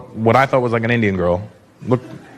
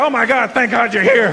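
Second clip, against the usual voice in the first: relieved welcome; 5 semitones higher than usual. Acted.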